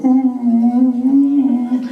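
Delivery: high loud moose call; moose holler. Acted. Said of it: "And I just go".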